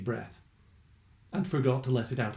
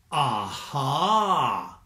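meaningful aha. A narrator's reading. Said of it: "he said meaningfully".

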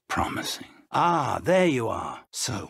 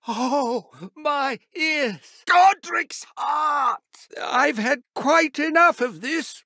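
high, loud exasperated cries; pained outburst; 11 semitones higher, 3 dB louder, slower than usual. Acted. Professor blasted by a loud spell, pained outburst. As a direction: cry out, ears ringing, fed up. Deafened in a duel.

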